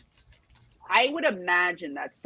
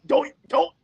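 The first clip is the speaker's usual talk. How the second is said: mock panic; 7 semitones higher, 4 dB louder than usual. Voices freezing before a spider.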